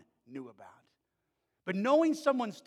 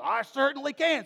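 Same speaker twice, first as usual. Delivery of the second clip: defiant shout; 9 semitones higher, 9 dB louder than usual. Retold or imitated. Boy boasting in basketball argument.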